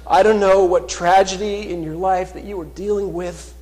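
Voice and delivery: loud, high, rising sermon voice, slowing on key words; fervent pulpit proclamation; full-force preaching. Acted. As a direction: preach it out to the whole church. Preaching appeal about struggles.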